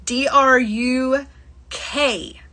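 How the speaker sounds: slow, letter by letter; each letter drawn out separately; spelled out. Shown as a demonstration. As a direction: spell it out with a pause before the last letter. Spelling out the bead name.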